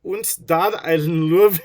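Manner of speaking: loud, high mock German accent; over-the-top German accent. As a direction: ham up a German dad-loving son. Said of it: "How's a German talk?".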